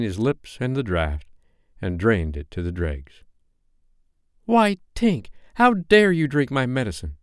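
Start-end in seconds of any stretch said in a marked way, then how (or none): 4.4-7.2 high, indignant scolding; affronted boyish protest. Acted. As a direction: scold in outrage, pitch leaping up. Peter scolds Tink for drinking.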